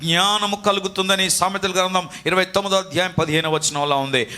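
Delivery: ordinary talk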